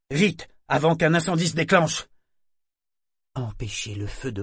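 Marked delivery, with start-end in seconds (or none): 0.1-2.1 sharp, loud, higher bark; harsh barking commands. Acted. Urgence de l'incendie. (ordre sec et crié)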